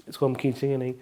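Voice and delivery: usual speaking voice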